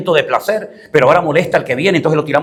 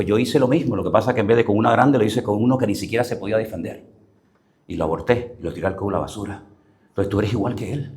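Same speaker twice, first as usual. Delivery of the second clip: confesión en voz más baja y grave; retold shamefaced admission, sinking lower and softer; avergonzada; 5 semitones lower, 6 dB quieter than usual. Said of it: "se le cayó la cara de vergüenza". (avergonzada, bajando la voz)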